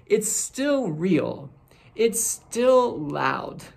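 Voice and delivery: "still" stretched out, slow and rising; slow down and lean hard on "still"; building preacher emphasis; slow emphatic preaching. Acted.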